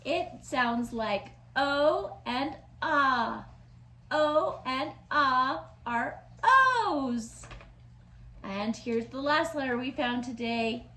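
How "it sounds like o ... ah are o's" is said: "O" said slowly as oh, then ah; two O sounds. Shown as a demonstration. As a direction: stretch out the O and ah sounds. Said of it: "O has two sounds too".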